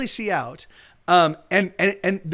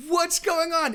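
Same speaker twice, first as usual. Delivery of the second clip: much higher, bewildered; bewildered exclamation; 10 semitones higher, slower than usual. Retold or imitated. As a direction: act out high-pitched confusion. Bewildered at being kicked.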